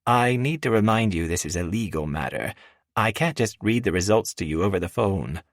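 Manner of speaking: a hint of a firm assistant; professional woman voice. A narrator's reading. Miranda voiced by narrator.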